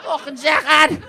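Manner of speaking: loud, high Scouse squawking; two hammered Scousers rowing over food; drunken Scouse. Acted. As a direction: shriek it in thick Scouse like a drunken fight. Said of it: "two Scousers fucking hammered from Bootle".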